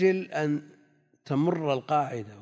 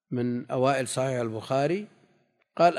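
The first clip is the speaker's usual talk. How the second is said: ordinary delivery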